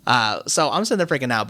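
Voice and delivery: ordinary delivery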